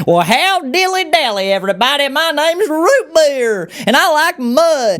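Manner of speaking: high, slow, hammed-up cartoon Southern drawl; mock Southern accent. Mocking how popular media fakes a Southern accent, high and slow. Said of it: "sound like".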